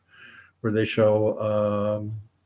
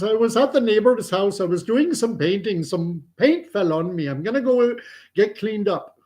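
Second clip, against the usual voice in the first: higher-pitched explaining voice; lightly voiced grandson; 9 semitones higher than usual. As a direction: explain the mishap in a raised voice. Acted grandson explaining himself.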